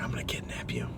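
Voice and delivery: put-on whispered low to the baby. Cooing to the baby he is holding, whispering. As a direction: whisper it like a secret plot. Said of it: "I was like".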